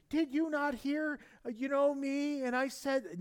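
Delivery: high-pitched imitation of the wife; mock annoyed wife. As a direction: play the nagging wife, hammed-up. Husband voices annoyed wife.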